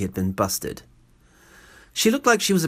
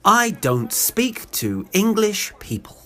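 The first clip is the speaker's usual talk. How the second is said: slow, higher-pitched, every word spelled out; pointed clarification; 8 semitones higher, 4 dB louder, much slower than usual. Acted. Woman snubbing the English, slow and pointed. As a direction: say it at half speed, pitched up. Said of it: "What she probably meant to say was".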